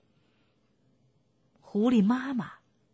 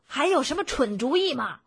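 higher, moderately raised shout; 7 semitones higher, 3 dB louder than usual. A narrator's reading. Bunce repeating himself to deaf Bean, shouting. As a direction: call it out for deaf ears. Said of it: "邦斯大声地喊道".

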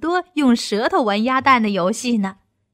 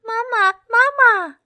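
high, loud little duckling cry; excited baby duckling shouting for mama; duckling calling; 12 semitones higher, 6 dB louder than usual. A narrator's reading. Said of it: "它还喊着".

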